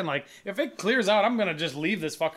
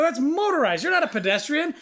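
high, insistent correction; exasperated retort; 8 semitones higher, 3 dB louder than usual. Retold or imitated. Driver corrects scooter rider mid-argument, exasperated. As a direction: re-enact arguing the point hotly. Retelling his roadside argument.

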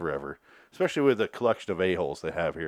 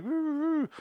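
put-on high squeaky little-kid "woo"; high-pitched child's play sounds; toddler noises. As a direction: coo it up high like a happy toddler. Toddler playing with a truck.

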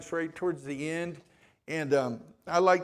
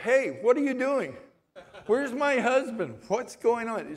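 much higher, louder, alarmed questions; startled outcry; 8 semitones higher, 5 dB louder than usual. Retold or imitated. Woman waking in hospital calls out for her husband, startled. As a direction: jolt awake and demand answers. Wife wakes from coma.

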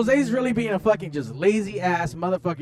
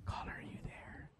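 whispering; 24 dB quieter than usual. Real, not acted. Whispering to the caller.

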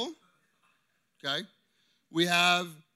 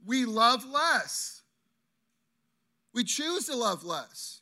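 slowed, stressed less; slow emphasis; 3 semitones higher, slower than usual. Real, not acted. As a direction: draw out "less" with weight. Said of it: "emphatic sermon point".

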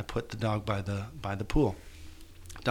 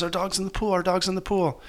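higher, retelling his wife's frantic alarm; retold hysterical cry; 8 semitones higher than usual. Wife shouting that the dog is in the pool, higher frantic retelling. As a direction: echo her panic, pitched up high. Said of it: "she's hysterical".

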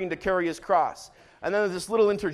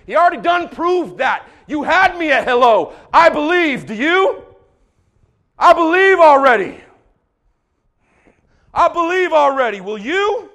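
loud, raised preacher's declaration; fervent shouted testimony; impassioned preaching; 5 semitones higher, 8 dB louder than usual. Real, not acted. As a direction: proclaim it at full voice. Preacher's impassioned climax and challenge.

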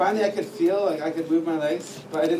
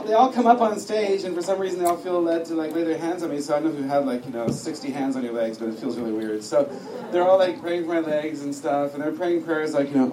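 usual speaking voice

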